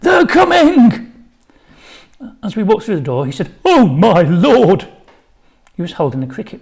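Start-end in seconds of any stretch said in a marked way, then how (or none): 0.0-1.1 very high, loud, slow yell; shouting. A narrator's reading. 3.6-4.9 loud, drawn-out gasp of shock; slow horrified cry; shocked exclamation. A narrator's reading. PE teacher sees the stranger.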